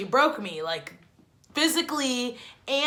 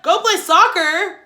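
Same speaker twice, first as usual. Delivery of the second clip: higher, louder, slow disbelieving echo; incredulous; 8 semitones higher, 11 dB louder than usual. Retold or imitated. Friend Jose asks her to play soccer, incredulous repeat. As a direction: throw the question back in disbelief.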